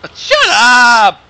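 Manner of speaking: loud, slowed shout of disgust; loud groan of a shut up. Real, not acted. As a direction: yell at him to shut up. Yelling at a gross remark.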